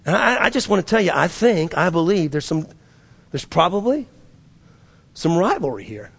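normal talking voice

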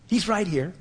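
high, loud, building sermon cry; fervent preaching. Acted. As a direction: preach it with full fire. Preaching climax.

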